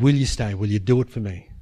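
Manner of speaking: low, gentle question; voice of the Spirit. Retold or imitated. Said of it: "He said".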